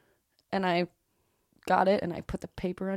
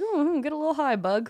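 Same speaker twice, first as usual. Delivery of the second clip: playful coaxing; 7 semitones higher than usual. Retold or imitated. Playful talk to a bug.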